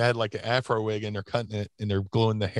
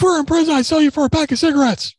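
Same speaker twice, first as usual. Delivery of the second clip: Bruiser Bedlam imitation; 7 dB louder than usual. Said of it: "our imitation of Bruiser Bedlam".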